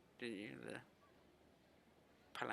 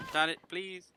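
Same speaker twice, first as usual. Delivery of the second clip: higher, louder plea; desperate call; 8 semitones higher, 9 dB louder than usual. Acted. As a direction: cry out, pleading. Calling out desperately.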